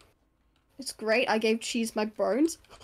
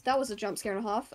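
normal talking voice

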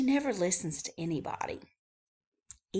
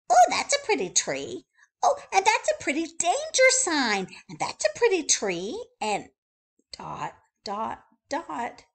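high, eager rattling list; chatty bird voice; 8 semitones higher, 8 dB louder than usual. Acted. Wordy Birdie telling readers what she likes, high and eager. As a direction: gush through the list.

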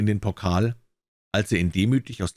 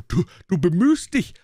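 high, loud stammering rage; furious shout; 9 semitones higher, 4 dB louder than usual. A narrator's reading. Tyrant screaming at the dwarf, furious. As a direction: spit it out in fury. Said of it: "schrie der Tyrann wütend".

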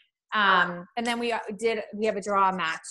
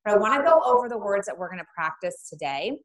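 ordinary talk

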